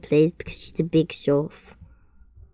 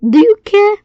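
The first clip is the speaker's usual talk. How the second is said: much higher, loud and cheeky; sassy challenge; 13 semitones higher, 9 dB louder than usual. A narrator's reading. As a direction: toss it at the listener with attitude. Sassy question to the listener.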